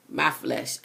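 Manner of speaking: normal talking voice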